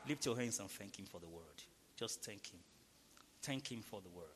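quiet, low prayer invitation; hushed worship prompt; soft prayer lead. Acted. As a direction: gently lead them into thanks. Leads quiet thanksgiving prayer.

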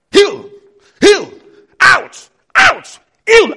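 high, loud commands, each word hammered down; commanding shout. Retold or imitated. Preacher reliving his first healing, shouting commands. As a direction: bark the healing orders like a man of God on fire.

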